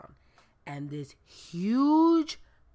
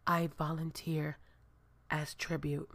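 mock-solemn declaration; 3 semitones lower, 8 dB quieter than usual. Mock-solemn film quote before whistled tone.

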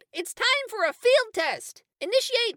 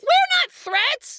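slow, higher, louder protest; indignant shout; 5 semitones higher, 4 dB louder, much slower than usual. Acted. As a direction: drag out the protest. Protesting the robot.

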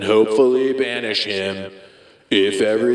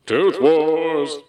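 announcer voice; 6 semitones higher than usual. Acted. Announcing segment title.

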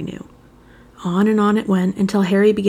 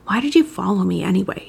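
usual speaking voice

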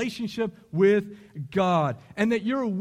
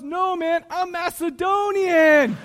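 put-on slow, higher Macedonian-accented reply; 8 semitones higher, 6 dB louder, slower than usual. Boro turning down the frat guy, Macedonian accent. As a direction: answer proudly in a Macedonian accent. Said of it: "And Boro responds".

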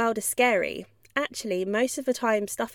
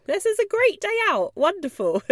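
much higher, gushing and bright; a thrilled dog on an outing; delighted dog; 11 semitones higher, 4 dB louder than usual. Pretend. Happy dog at the vet, much higher gushing. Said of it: "seeing the situation as".

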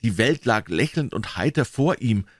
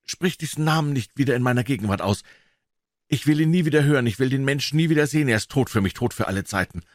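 trembling voice; 7 semitones higher, faster than usual. Acted. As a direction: cry out, shaking with terror. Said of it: "Mit bebender Stimme rief er".

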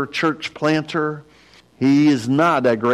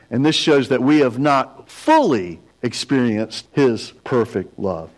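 usual speaking voice

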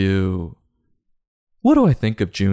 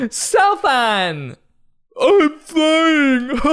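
put-on excited kid voice; 4 dB louder, much slower than usual. Kids screaming on the train ride.

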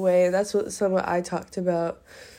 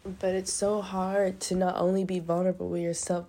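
ordinary talk, nothing marked

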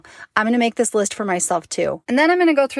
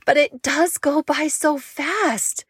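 wistful; 6 semitones higher, much slower than usual. Real, not acted. Wistful realization.

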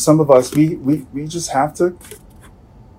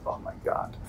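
breathless whispered dismay; 20 dB quieter than usual. Retold or imitated. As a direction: whisper it in shock. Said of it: "we're like".

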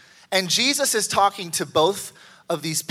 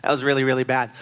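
inner voice; 8 semitones lower, 4 dB quieter than usual. Retold or imitated. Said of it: "and you're like".

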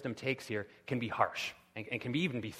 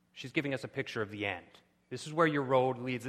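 ordinary talk

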